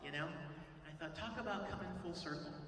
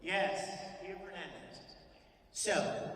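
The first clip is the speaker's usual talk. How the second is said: ordinary delivery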